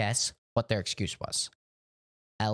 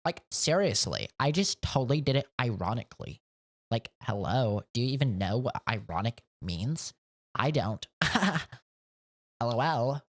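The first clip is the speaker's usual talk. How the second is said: put-on rising, bouncy Valley Girl voice; Valley Girl accent. Streamer mocking a coworker in a Valley Girl accent. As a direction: play the Valley Girl with every phrase lilting up. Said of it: "Called you a valley girl".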